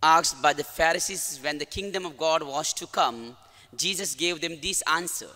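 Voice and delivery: solemn, measured gospel proclamation; gospel reading. Acted. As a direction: read the gospel with reverence. Priest reads the gospel.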